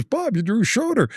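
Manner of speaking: high-pitched quoted greeting; Bruce Baumgartner. Retold or imitated. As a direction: call it out high, imitating a fellow wrestler. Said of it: "He goes".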